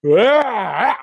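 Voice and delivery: a loud startled shout; sharp surprised yelp. Real, not acted. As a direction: yelp out suddenly. Sudden exclamation.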